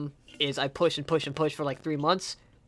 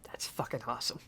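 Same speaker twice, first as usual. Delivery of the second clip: awed whisper, much softer; whispering to himself, moved by the song; 4 semitones lower, 11 dB quieter, faster than usual. Real, not acted. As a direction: breathe it out in quiet awe. Moved, reacting under his breath.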